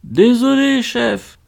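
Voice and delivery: high, startled shouted apology. A narrator's reading. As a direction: yell back, caught off guard. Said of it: "hurla-t-il".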